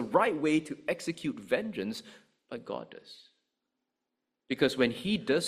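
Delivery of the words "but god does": hushed aside. Real, not acted. Quiet assurance after escalation.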